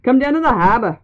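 lightly voiced Boston accent; a hint of a Boston dock worker. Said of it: "he's like".

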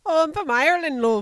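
loud, high put-on Irish accent; mock Irish accent. Teasing friend about being from Ireland, mock Irish accent. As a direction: belt out a hammed-up Irish accent. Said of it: "talk like that again".

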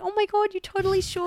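excited reply. Retold or imitated.

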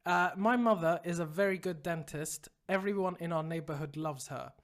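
low, subdued letter-reading voice; reading email aloud. A narrator's reading. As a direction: read the listener's problem out evenly. Reads listener email aloud.

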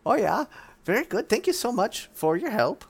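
high pleading druid tale; Denier's voice. Acted. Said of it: "Denier says".

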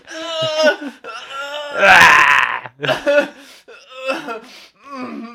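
helpless laugh mixed with crying; laughing so hard it hurts; pained laughter. Real, not acted. Laughing through rib pain.

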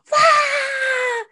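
high, loud mock cheer from the crowd; imitated crowd roar. Recalling the crowd cheering her on stage, a loud mock roar. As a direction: whoop like a roaring audience.

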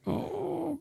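high, soft, slow little sigh of oh; a high breathy drawn-out mock oh; mock swoon. Mocking potato puns with a high, slow soft oh.

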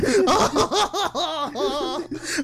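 high helpless cackle; real high-pitched laughing fit; dying laughing. Real, not acted. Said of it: "dying laughing".

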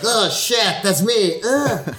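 high mock shock. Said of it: "I was like".

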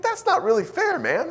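very high, loud mock protest; plaintive objection. Pastor objecting to his friend's avoidance, high and plaintive.

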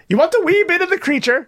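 high, squeaky mock Scottish accent; Scottish fantasy creature. Friend hams up a Scottish fantasy creature, high mock Scottish. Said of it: "they're like".

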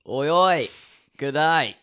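loud cheery Australian oi oi; mock Australian greeting, loud and high; Australian accent. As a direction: shout it like an Aussie larrikin. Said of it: "carry on the Australian accent".

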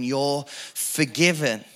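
usual speaking voice

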